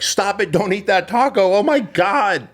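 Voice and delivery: high, loud, hammed-up panic; frantic mock yell. Banging on a stranger's car window over a taco, frantic mock shriek. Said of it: "knock down their window, like".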